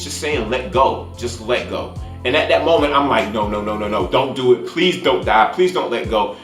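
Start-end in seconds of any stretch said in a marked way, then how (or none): none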